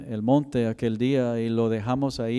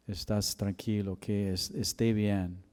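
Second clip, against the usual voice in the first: tender hush; 4 semitones lower, 7 dB quieter than usual. Real, not acted. Tender aside after testimony.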